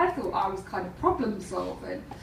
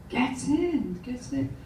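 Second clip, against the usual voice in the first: tentative, tailing off softer; a bit unsure; 3 dB quieter, slower than usual. Retold or imitated. Poker player unsure of her hand, hesitant. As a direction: say it with doubt, fading on the repeat. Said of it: "she was a bit unsure".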